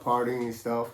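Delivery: ordinary delivery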